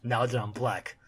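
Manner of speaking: soft, understated brooding voice-over; inner monologue. Acted. The Punisher thinking to himself, soft inner monologue. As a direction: think it to yourself, quietly. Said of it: "Punisher thinks himself".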